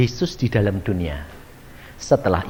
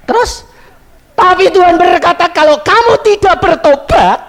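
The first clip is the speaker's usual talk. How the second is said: loud, high preaching warning; 9 dB louder than usual. Acted. Preacher proclaiming the Lord's warning to the congregation. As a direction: thunder out the warning.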